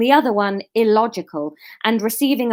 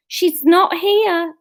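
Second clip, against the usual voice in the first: Kenneth Williams voice; 8 semitones higher, 5 dB louder, slower than usual. Acted.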